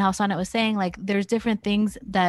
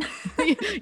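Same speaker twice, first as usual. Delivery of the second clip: laugh; 12 semitones higher than usual. Real, not acted. Laughing at own example.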